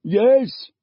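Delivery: father yelling above the roar; yelled. A narrator's reading. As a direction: shout over the roar. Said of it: "yelled her father".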